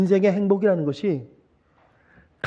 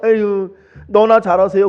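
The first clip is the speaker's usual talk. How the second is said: mock grumble; 6 semitones higher, 7 dB louder, slower than usual. Congregants grumbling behind him.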